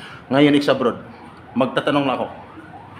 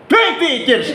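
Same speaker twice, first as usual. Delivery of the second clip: higher and louder, surprised; 13 semitones higher, 4 dB louder, slower than usual. Real, not acted. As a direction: react amazed at the number. Surprised at long abroad. (gulat na gulat)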